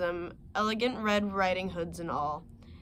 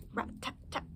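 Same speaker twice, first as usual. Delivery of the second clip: ordinary delivery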